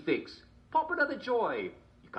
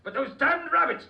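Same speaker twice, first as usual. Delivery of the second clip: mock outburst; 8 dB louder than usual. King rants about rabbits.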